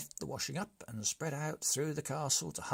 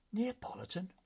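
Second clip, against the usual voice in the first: high, incredulous question; startled repeat of the flavour; surprised echo; 8 semitones higher than usual. Acted. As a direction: echo it in disbelief.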